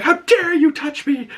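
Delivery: very high, shrill mock outrage; imitating outraged queen. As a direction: snap at the guard in fury. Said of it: "and she's like".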